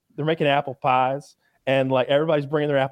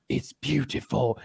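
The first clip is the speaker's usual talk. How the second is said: softer, slow and tender; quoting Gordon; 6 dB quieter, slower than usual. Retold or imitated. Gordon reassuring the crying contestant, softly and slowly. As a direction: say it gently to comfort her. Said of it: "and he's just like".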